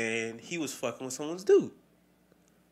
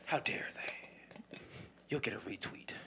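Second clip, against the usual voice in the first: slow sarcastic whisper; mock hushed outrage; 9 dB quieter, slower than usual. Mocking online outrage to a friend, sarcastic whisper. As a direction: whisper it with fake shock.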